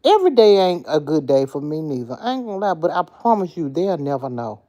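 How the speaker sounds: ordinary talk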